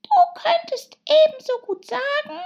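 extremely high, loud dormouse voice; sleep-talking dormouse. Acted. Dormouse chiming in from sleep, high squeaky sleep talk. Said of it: "die im Schlaf zu sprechen schien".

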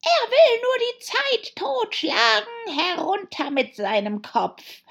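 high, loud, drawn-out royal shriek; screeching high at full volume; queen's bellowing. A narrator's reading. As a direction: roar the order like an enraged queen. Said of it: "als die Königin losbrüllte".

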